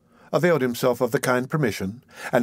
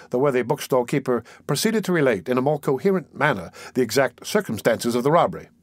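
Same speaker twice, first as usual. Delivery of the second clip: usual speaking voice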